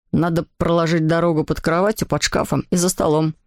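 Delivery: low-voiced brother giving instructions; boy explaining. A narrator's reading. Anselm explains the track route to his friend, pitched low. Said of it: "объяснил мне Ансельм".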